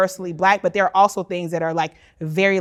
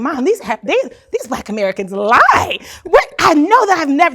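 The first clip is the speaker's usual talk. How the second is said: put-on indignant child complaint; 6 semitones higher, 7 dB louder than usual. Retelling complaint to mom as child.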